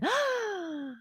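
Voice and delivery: high, drawn-in gasp; exaggerated scandalized gasp; mock shocked gasp. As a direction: gasp theatrically in feigned shock.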